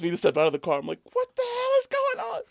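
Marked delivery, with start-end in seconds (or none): 1.1-2.4 high, loud mock disbelief; shocked disbelief. Asked out of the car, friend squeals in disbelief. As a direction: squeal it, way up in pitch. Retold confusion at police stop.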